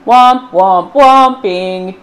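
loud, heavy tuba womp. Acted. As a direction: puff it out like a tuba. Imitating tubas.

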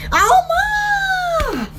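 high surprised squeal; 높은 놀람의 소리; high surprise. Real, not acted.

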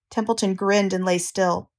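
ordinary delivery

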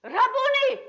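high, loud ecstatic cry of recognition; loud high joyful shout. Acted. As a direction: cry it out from your knees, overwhelmed. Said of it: "as I cried out".

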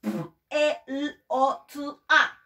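"P L O T A" letter by letter, slowly; slow letter names; spelled slowly. Shown as a demonstration. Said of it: "quiero que me traigas una".

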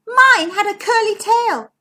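high, loud little-cousin voice; squeaky. A narrator's reading. Zara rejects the lizard as her monster, squeaking. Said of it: "squeaked Zara".

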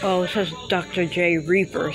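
ordinary talk, nothing marked

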